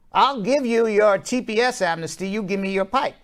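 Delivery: a Southern congressman haggling, laid on thick; Southern accent. Retold or imitated. Congressman trading a pipeline for amnesty, mock Southern accent. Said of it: "using my Southern accent".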